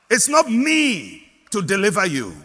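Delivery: ordinary delivery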